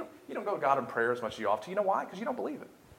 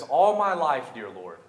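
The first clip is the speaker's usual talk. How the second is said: pleading aloud to God, mostly high and loud; preacher acting out a fervent, surging prayer; Hannah's impassioned prayer; 6 semitones higher, 10 dB louder than usual. Hannah pleading with the Lord for a son, impassioned prayer. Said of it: "she says".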